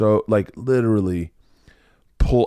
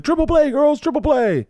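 much higher, louder triumphant call; excited coach cheer; 4 dB louder than usual. Retold or imitated. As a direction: celebrate the fake triple play. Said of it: "And he's like".

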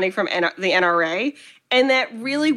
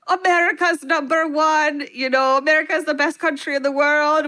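higher-pitched catch-all mock Russian accent; bad Russian accent; 5 semitones higher than usual. Retelling creepy Russian purge tourists bragging about America, bad Russian accent. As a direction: put on a dodgy, high Russian accent. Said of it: "Is that your Russian accent".

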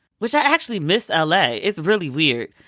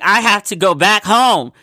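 loud, high, slow exasperated protest; retold protest; 5 semitones higher, 6 dB louder than usual. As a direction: imitate yourself insisting over everyone, stretching each word. Retold protest at pressure to stay.

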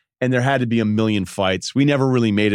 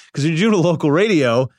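normal talking voice

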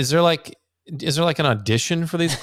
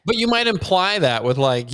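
usual speaking voice

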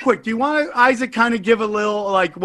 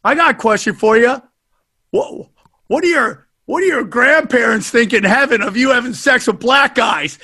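loud, higher provocative heckle; 4 semitones higher, 4 dB louder than usual. Retold or imitated. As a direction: bait her with a nasty question. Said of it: "Ari's gonna play the amazing racist".

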